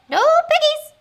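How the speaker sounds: very high, loud hen voice; high-pitched poultry squawk; squawking chicken. Acted. Chicken answers in clucking story.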